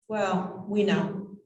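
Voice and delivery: ordinary talk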